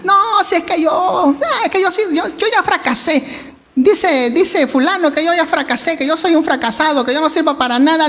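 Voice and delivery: high whiny self-pity; defeated youth. Retold or imitated. Pastor mimics a discouraged young person, high whiny voice. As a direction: whimper like one who gave up. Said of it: "no digan".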